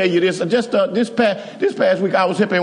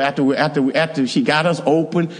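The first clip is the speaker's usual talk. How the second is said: normal talking voice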